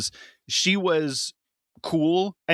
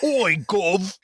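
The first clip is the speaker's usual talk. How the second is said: hammed-up fake British, pitched higher; bad British accent; 6 semitones higher than usual. Shown as a demonstration. Co-host shows how people fake British, hammed-up bad British accent. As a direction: do a badly faked British street accent. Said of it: "people who don't know how to do a British".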